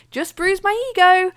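cheerfully pretending all is fine; cheery. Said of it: "ran past them very cheerily saying".

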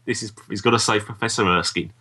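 ordinary delivery

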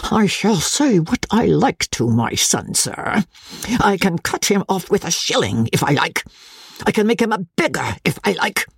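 high, insistent indignant threat; overbearing anger. A narrator's reading. Old Osborne threatens to disinherit his son, indignant.

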